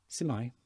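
"Simai" modelled clearly, see-my; model for repeating. Shown as a demonstration. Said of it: "So have a go".

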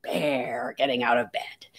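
low growly waking-bear voice; low, playful bear impression; pretend bear. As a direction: groan it out deep like a bear leaving bed. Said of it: "I pretend to be a bear".

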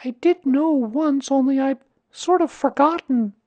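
put-on high, loud boy's voice; Christopher Robin. A narrator's reading. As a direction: play a boy covering his forgetting. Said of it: "said Christopher Robin carelessly".

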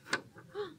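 sharp breathy gasp of surprise; quick startled intake of breath; surprised gasp. Acted. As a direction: gasp like you just found something. Storyteller gasps at discovery.

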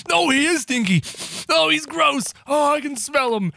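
high-pitched revolted exclaiming; hammed-up disgust. Disgusted by smelly monster.

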